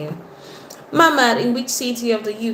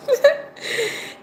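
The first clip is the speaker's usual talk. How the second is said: high-pitched burst of laughter; short high giggle. Real, not acted. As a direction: laugh high, caught off guard. Laughs at a comment.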